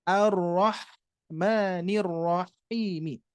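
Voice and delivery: ar-rahmanir rahimi chanted very slowly, ha from mid-throat; Quranic recitation. Shown as a demonstration. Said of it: "Sama dengan posisi dengan huruf ain".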